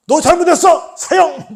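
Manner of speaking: loud, high mock verdict; acted furious sentencing; harsh condemnation. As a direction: shout the sentence like a merciless judge. Acted harsh judge passing sentence.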